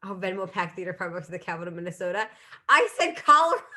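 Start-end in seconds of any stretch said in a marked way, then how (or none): 2.7-3.8 loud, high laugh breaking through. Real, not acted. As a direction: laugh at your own mistake. Laughs at her own wrong answer.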